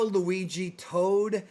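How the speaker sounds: ordinary talk, nothing marked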